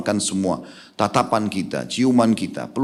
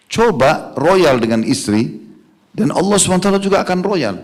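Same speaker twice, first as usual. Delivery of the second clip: fervent exhortation; 8 semitones higher, 4 dB louder than usual. Real, not acted. Preaching climax.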